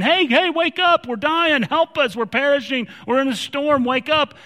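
high, loud frantic cries for help; panicked yelling. Acted. Disciples waking Jesus in the storm, panicked yell. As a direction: yell to rouse a sleeper. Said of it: "Everyone's yelling at them".

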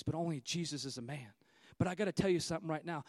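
ordinary talk, nothing marked

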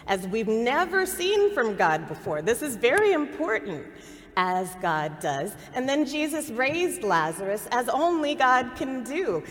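loud, high preaching at the climax; full-force preaching. Acted. Preacher proclaiming God's glory to the congregation, full force. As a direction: drive it home from the pulpit, building.

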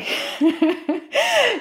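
short high laugh; a quick giggle; amused laugh. Real, not acted. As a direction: laugh at the irony of it. Laughs recalling the auction.

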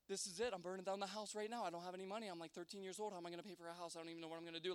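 low, hushed panicked rambling; freaking out inwardly. Retold or imitated. Boy burning down the house, retold hushed panic. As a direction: fret softly under your breath, acted. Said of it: "I'm freaking out".

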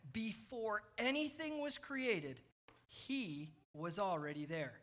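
slow, deliberate Bible reading; scripture reading. Acted. Preacher reading Colossians to kids following along, measured scripture reading. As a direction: read the verse out slowly, phrase by phrase. Reads scripture aloud to children.

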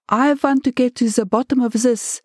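put-on high, insistent German-accented demand. A narrator's reading. Angry German boss demands the truth.

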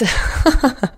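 light, amused little laugh; short laugh. Real, not acted. Parent admitting to skipped baths, short amused laugh. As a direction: chuckle briefly at your own admission. Laughs at her own admission.

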